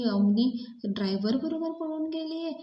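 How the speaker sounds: high, stunned disbelief; father learning his daughter ran off with the driver, shocked. Retold or imitated. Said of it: "त्यांना खूप shock बसलेला असतो".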